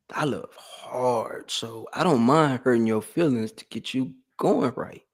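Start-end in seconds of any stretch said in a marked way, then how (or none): 0.1-1.5 "I love hard" softer and very slow; soft slow repeat. Shown as a demonstration. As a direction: let "I love hard" fall to a quiet murmur.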